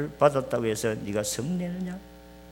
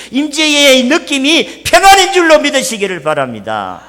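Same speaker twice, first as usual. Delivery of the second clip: louder, higher, insistent preaching; fervent preaching; 10 semitones higher, 8 dB louder than usual. Acted. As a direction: plead with the congregation at full force. Preaching at full force.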